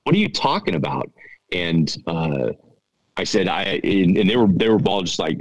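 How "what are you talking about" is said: shocked disbelief. Retold or imitated. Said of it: "And Ryan was like".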